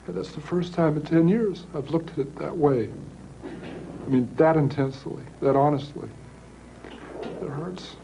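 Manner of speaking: fighting tears as the confession lands; choked up. Real, not acted. Killer admitting the truth in an interview, real choked-up voice. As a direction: voice rising and breaking with held-back tears. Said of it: "he gets choked up".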